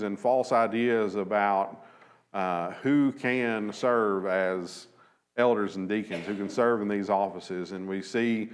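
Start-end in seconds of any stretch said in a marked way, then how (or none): none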